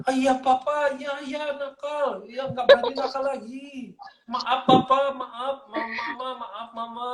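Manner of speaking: high-pitched child's mock pleading; pura-pura minta ampun. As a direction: act out a kid faking cries for mercy. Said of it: "Eh, pura-pura". (anak pura-pura merengek minta maaf)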